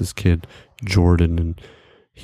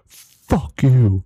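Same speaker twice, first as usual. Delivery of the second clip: higher, drawn-out mock curse; imitated angry outburst; 5 semitones higher, 3 dB louder than usual. Offended friend snapping back at him, mock anger. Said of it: "his face just goes to this angry face".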